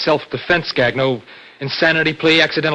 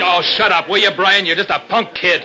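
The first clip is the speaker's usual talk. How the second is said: raised, irritated dismissal; angry put-down; 5 semitones higher, 4 dB louder than usual. Acted. Older brother telling the punk kid off, angrily. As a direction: cut him down with open contempt.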